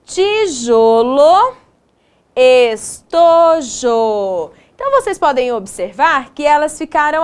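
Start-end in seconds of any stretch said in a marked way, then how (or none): 0.0-4.5 drawn out syllable by syllable; slow, stretched words. Shown as a demonstration. Teacher reviewing words with second graders, slow and stretched. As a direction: stretch each word for the children. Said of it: "duas sílabas jo".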